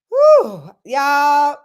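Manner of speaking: loud, high exclamation of amazement; overwhelmed loud whew; big whew. Real, not acted.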